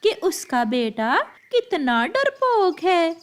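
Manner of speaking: high, gloating crow mother; mother crow jeering at the coward's family; mocking mother. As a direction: sneer it with relish. Mother crow mocks Kiki.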